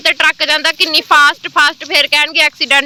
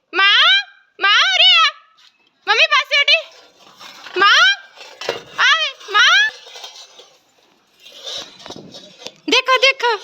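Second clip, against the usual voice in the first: high calling out; 10 semitones higher than usual. Real, not acted. Calling the animal over.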